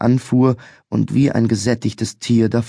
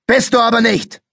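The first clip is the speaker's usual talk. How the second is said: much higher, louder sudden yell; sudden scream; 12 semitones higher, 3 dB louder than usual. A narrator's reading. Mustached policeman suddenly screaming at the boy, angry. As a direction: snap into a shout. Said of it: "schrie der mit dem Schnauzer plötzlich".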